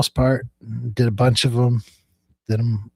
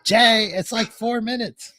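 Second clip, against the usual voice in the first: calling out; 9 semitones higher, slower than usual. Real, not acted. Calls out to Jay on phone.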